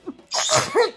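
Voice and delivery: sudden real sneeze; a sharp burst of sneezing. Real, not acted. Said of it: "j'éternue".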